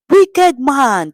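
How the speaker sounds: crowd says loudly. A narrator's reading. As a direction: condemn him loudly. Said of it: "they said loudly".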